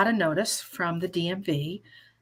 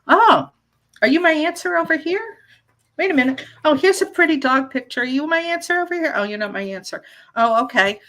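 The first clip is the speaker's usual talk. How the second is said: curious searching; 7 semitones higher, 5 dB louder, faster than usual. Pretend. Acting out searching for signs curiously.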